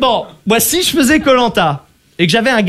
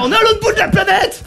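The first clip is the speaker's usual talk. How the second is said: high-pitched, exasperated disbelief; incredulous outcry; 10 semitones higher than usual. Acted. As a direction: cry out in disbelief, voice shooting up. Protesting at contestant's absurd claim. (cri incrédule, voix qui monte)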